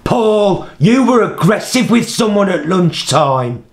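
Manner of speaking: high, loud accusing yell; shouting. Retold or imitated. CEO confronts carer on entry, high mock shout. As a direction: re-enact a boss yelling the moment he walks in. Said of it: "don't you dare shout at".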